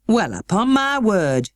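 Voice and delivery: slow, astonished Yorkshire housekeeper; a stern woman caught off guard; broad Yorkshire. A narrator's reading. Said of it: "speaking rather broad Yorkshire herself".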